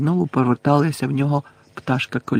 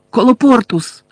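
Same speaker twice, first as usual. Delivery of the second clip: high, loud incantation; exhaled spell cry; 9 semitones higher, 6 dB louder than usual. A narrator's reading. Hermione sealing the door with a spell, high loud cry. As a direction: cast it urgently on a raised pitch. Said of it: "видихнула Герміона".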